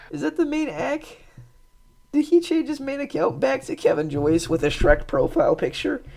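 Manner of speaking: amazed, half laughing; incredulous. Real, not acted. Shocked at Shrek profile account.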